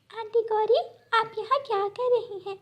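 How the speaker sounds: put-on high, innocent child's voice; little girl bird. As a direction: politely greet the crow auntie. Little bird greets crow.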